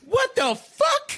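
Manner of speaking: indignant what the fuck; shocked outrage. Retold or imitated. Friend reacting to insult.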